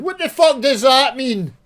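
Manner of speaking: high, loud outburst at the jargon; outraged shout. Real, not acted. Ranting at the game makers, outraged shout. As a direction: bellow it in disbelief. Ranting about fancy corporate words.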